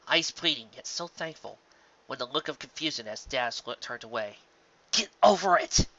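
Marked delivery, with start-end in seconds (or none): 4.9-5.9 sharp self-scolding. Acted. Dash scolding herself inwardly.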